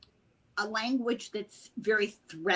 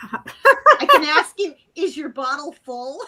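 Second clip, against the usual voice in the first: laughing through the words, louder and higher; 5 semitones higher, 12 dB louder than usual. Real, not acted. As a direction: giggle out the silly phrase. Laughing at her own Irish phrase.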